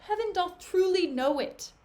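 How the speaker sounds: acted, impassioned plea of innocence; emphatic protest. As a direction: swear it insistently to an accuser. Desdemona swears her honesty.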